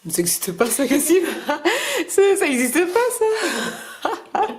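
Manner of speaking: real laughter breaking in, pitch climbing; laughing disbelief. Real, not acted. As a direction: protest through high-pitched laughs. Amused reaction to a child's invention.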